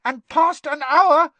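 slow, loud, very high elf voice; squeaky house elf. A narrator's reading. Dobby warning Harry of the deadline, shrilly squeaky. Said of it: "squeaked Dobby".

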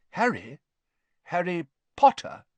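name said in rising disbelief; amazed recognition. A narrator's reading. Amos meeting the famous Harry, astonished.

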